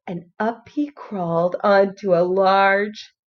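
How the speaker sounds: ordinary delivery, nothing marked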